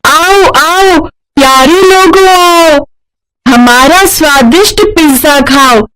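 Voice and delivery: high, sing-song street vendor call; calling out to customers. Acted. Girls at the roadside calling customers to their pizza, high sing-song hawking. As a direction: hawk the pizza to passersby, high and drawn out. जोर जोर से लोगों को आवाज देकर बुलाने लगती.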